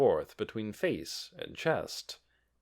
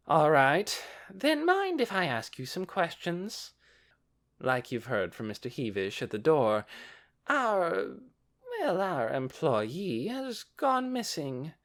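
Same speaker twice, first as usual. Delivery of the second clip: oily merchant explaining; smooth merchant voice; 5 semitones higher than usual. A narrator's reading. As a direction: feign concern, smoothly. Succulent explains.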